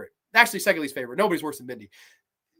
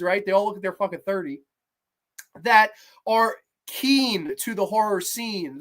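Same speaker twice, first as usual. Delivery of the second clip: ordinary delivery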